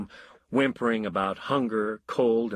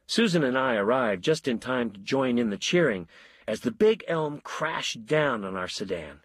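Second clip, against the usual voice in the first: plain reading voice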